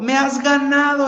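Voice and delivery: high cry of defeat; crying out to God. Retold or imitated. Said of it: "empecé a gritarle a Dios".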